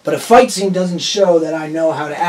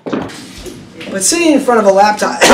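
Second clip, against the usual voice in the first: stuffy voice with a cold; 5 semitones higher than usual. Real, not acted. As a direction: talk through a head cold. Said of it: "sorry, I have a cold".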